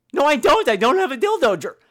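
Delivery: host loudly denying the gargoyle's claim; indignant protest. Real, not acted. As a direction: protest it's a lie. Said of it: "host denies louder".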